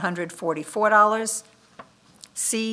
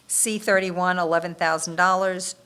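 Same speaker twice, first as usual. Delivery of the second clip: ordinary delivery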